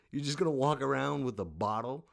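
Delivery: usual speaking voice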